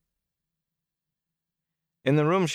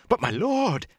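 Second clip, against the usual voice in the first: shocked protest. Acted. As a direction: cry out in dismay.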